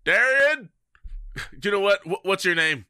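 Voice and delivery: loud, high call of a name. Acted. Player summoning Darian for help, calling out. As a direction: call out for someone in another room.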